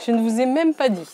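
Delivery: ordinary talk, nothing marked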